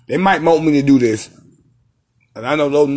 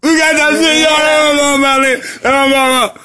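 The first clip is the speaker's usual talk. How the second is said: stoner hype; 9 semitones higher than usual. Real, not acted. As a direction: yell it out, high and hyped. Hyped shouting while high.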